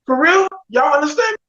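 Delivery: high, eager checking with the table; asking everyone if they heard it; amazed disbelief. Retold or imitated. Repeats question to the table.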